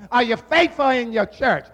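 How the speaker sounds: ordinary talk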